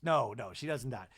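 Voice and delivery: ordinary delivery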